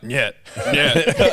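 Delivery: lightly imitated Russian official; repeated mock refusal, breaking into laughter. Russian guard refusing over the phone, laughingly imitated. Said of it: "guy just kept being like".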